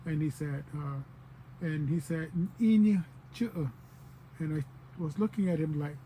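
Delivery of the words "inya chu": "Inya chu" slow, higher, leading with "ee"; boy's northern dialect. Retold or imitated. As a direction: quote the learner's drawn-out "Inya chu". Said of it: "northern dialect".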